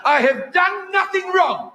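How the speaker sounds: put-on high, slow prim South African accent; mock offended South African clerk. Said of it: "And she went".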